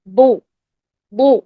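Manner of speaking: بو as a long, full-mouthed ضو; heavy ضاد with damma. Shown as a demonstration. Teacher drills damma for students, heavy ضو. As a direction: hold بو heavy, tongue sides back. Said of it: "ضمہ لگا کر practice کریں".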